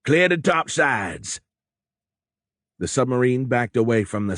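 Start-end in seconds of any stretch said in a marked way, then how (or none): 0.0-1.4 slow, higher barked command; bawled order. A narrator's reading. Moreau clearing the deck of sailors, bawled order. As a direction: shout it down the deck. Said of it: "Moreau bawled".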